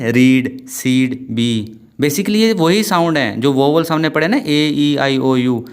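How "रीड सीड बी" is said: "रीड, सीड, बी", slow with held ee; long ee. Shown as a demonstration. Teacher sounding out the long vowel, drawn-out "रीड, सीड, बी". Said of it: "तो कैसे आएगी?".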